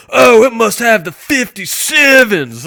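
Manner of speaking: loud, high, slowed show-off voice; mock truck bragger. Mocking people assuming old work trucks have big rims, loud. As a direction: sneer it like a rim-obsessed bro. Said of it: "and it was like".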